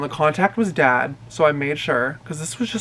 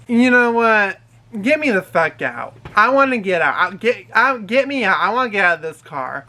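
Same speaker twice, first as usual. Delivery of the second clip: higher, insistent, stumbling repeats; mock belligerent; 4 semitones higher than usual. Belligerent passenger demands out of the car, mock outburst. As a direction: imitate a drunk demanding to be let out. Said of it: "she just starts getting belligerent".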